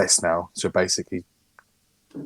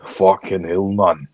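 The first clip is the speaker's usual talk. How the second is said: aggressive mock-Scottish accent; 3 semitones lower, 6 dB louder, much slower than usual. Co-host joking after failing at Geordie, aggressive Scottish accent. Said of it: "It's my Scottish accent".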